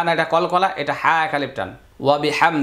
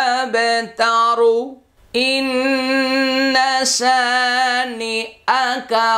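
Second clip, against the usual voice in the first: slow raised chant of the verse; Quran recitation; 4 semitones higher, much slower than usual. Shown as a demonstration. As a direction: recite with long drawn vowels and held ন্ন. (ধীরে উঁচু সুরে তিলাওয়াত)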